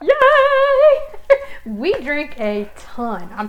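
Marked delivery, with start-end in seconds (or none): 0.0-1.4 high, loud squeal of joy; excited cheer. Real, not acted. Host cheering her co-host's eggnog joke, loud squeal.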